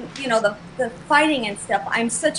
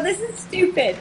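higher, dismissive quoted remark; scornful retort pitched up; saying it out loud; 7 semitones higher than usual. Retold or imitated. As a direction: blurt it out to the boyfriend. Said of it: "I go".

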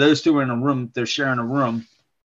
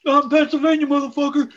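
mock tough guy. Mock quote as dad.

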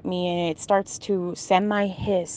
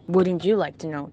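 ordinary talk, nothing marked

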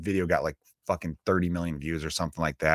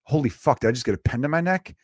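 mugger's startled panic, a touch higher; mock panic; 6 semitones higher, 3 dB louder than usual. Mugger stabbed with a pen, mock panic. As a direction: act out a shocked thug. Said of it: "their first thought is".

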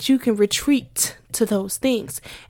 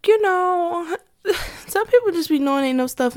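high squeaky laughing aside; 8 semitones higher than usual. Real, not acted. To viewers about clueless coworkers, laughing high. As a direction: giggle through it, half crying with laughter.

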